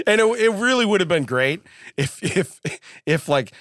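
usual speaking voice

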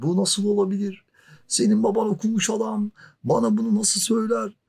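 high, indignant retold protest; indignant outburst. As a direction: protest it as if still hurt. (hâlâ kırgın, yüksek sesle itiraz)

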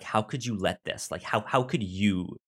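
normal talking voice

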